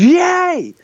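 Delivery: very high imitated "yay"; crowd cheer. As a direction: cheer again as the whole audience. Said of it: "it then goes".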